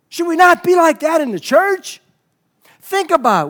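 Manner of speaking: loud, high preaching cry; impassioned challenge. Real, not acted. Preacher asks church to match convention zeal, impassioned. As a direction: throw the question out hard to the whole room.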